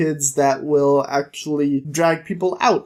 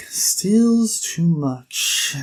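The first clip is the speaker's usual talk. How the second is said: very slow, stretched-out echo; eyeless priest; much slower than usual. Retold or imitated. Priest repeats the Thiefmaker's complaint, slow intrigued drawl. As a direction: savour each word, intrigued. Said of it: "the eyeless priest is like".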